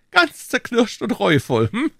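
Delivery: a king chiding his men, loud on the peaks; high, scolding royal voice. Acted. King scolding the musketeers, high indignant voice. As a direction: reprimand them from the throne.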